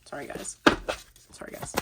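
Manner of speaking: barely voiced, very soft aside; hushed apology. Real, not acted. Reader apologizes to viewers mid-shuffle, hushed. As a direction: murmur it under your breath.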